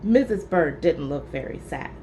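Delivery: plain reading voice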